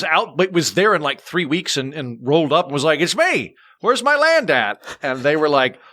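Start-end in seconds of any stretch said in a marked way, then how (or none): 3.0-4.8 high, drawn-out triumphant call; brash arrival. Retold or imitated. Landowner rolls up at the distillery, brash high-pitched announcement. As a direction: call out as if everyone expects you.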